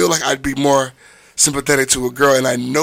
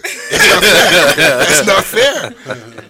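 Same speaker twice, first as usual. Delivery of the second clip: put-on whiny child; 3 semitones higher, 5 dB louder, much slower than usual. Mock complaint as child.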